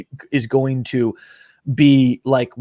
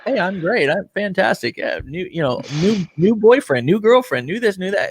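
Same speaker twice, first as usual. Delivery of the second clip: higher, bright-on-purpose fake happiness; mock fake cheer; 6 semitones higher than usual. Mocking people who pretend everything is perfect, fake cheerful. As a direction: sell a life that's falling apart as fantastic.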